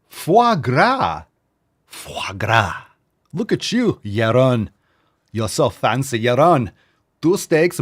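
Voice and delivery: hammed-up fancy European accent; mock foreign accent. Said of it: "That's not your accent, but that's what you talk like now".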